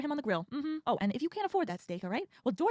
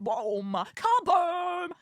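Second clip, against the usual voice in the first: mock explosion; 5 semitones higher, 4 dB louder, much slower than usual. Describing the fall.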